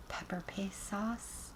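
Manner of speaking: slow breathy whisper. Real, not acted. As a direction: whisper it to the bowl. Adding sauce, hushed aside.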